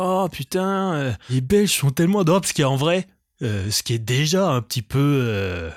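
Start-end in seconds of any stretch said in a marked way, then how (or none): 0.0-2.4 gushing twenty-something. Retold or imitated. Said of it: "Quand t'as la vingtaine, de dire".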